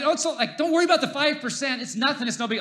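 high-pitched, hurried-sounding brush-off; mock reassurance. Acts himself brushing off his wife.

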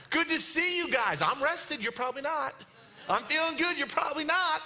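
high, cheerful mock greeting; breezy Jesus. Jesus waving at struggling rowers as he passes, mock cheery. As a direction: toss it off like a carefree passer-by. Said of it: "He's just waving at 'em".

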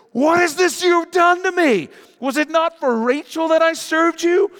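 high, loud, slowing accusation; outraged yell. Acted. Jacob shouting at his father-in-law, outraged. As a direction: hurl the accusation at him.

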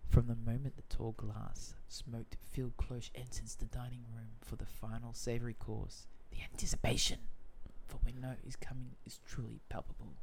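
soft, low, hushed reading; dramatic hush. Shown as a demonstration. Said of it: "dramatic hush".

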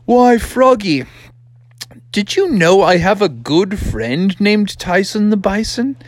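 high, playful dad voice; storybook frog dad. Acted. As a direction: ask your son as if revealing a treat. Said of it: "And he's like".

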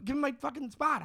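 lightly voiced tough dad insisting; dad demanding. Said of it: "he was like".

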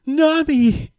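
a high, delighted cry of her name; excited call. Retold or imitated. Zeus spotting Nami, excited high call. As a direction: call out Nami happily as you spot her. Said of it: "he's like, gasps".

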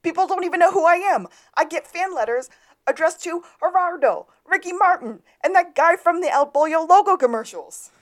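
high, loud indignant outburst; aggrieved rant. Acted. Podcaster acting a forgotten star's rant, high and indignant. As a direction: rail loudly with a high put-on voice.

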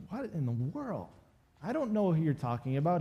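mock-incredulous, with swooping pitch; exasperated denial. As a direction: act out a baffled protest. Voicing Peter's second, exasperated denial.

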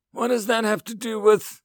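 high, loud retort; irritated. A narrator's reading. Alien counselor dismissing the human's question, irritated. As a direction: throw back an impatient question.

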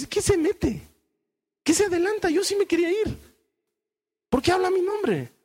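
high-pitched mock indignation; acted grumble of indignant followers; indignant objection. Grumbling disciples protest behind Peter's back.

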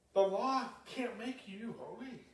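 fired-up sermon delivery; preaching at full force. Real, not acted. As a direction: drive the point home to the congregation.

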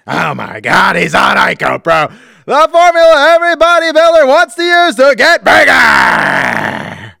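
high, over-the-top promo shout; shouted sports-announcer hype. Acted. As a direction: build from amazed cry to screamed sales pitch. Mock announcer plugging IcoPro.

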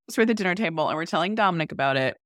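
ordinary talk

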